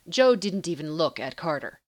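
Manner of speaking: plain reading voice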